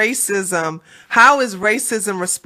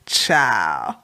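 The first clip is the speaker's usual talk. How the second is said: low dismissive drawl; 14 semitones lower, 3 dB quieter than usual. Pretend. Host dismisses.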